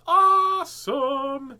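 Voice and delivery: high, loud, drawn-out delight; elated, long-held exclamation; delighted cheer. Real, not acted. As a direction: stretch it out in big happy celebration. Delighted at viewers feeling better.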